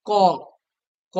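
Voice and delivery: ক held long so its final "aw" vowel rings out; drawn-out letter sound. Shown as a demonstration. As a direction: stretch ক into "kawww". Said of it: "ক উচ্চারণ কর".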